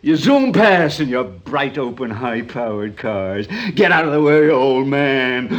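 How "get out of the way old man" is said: put-on jeering, raised voice at an old man; mock young driver. Old man mimicking a driver shouting at him. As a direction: sneer it like a reckless youngster.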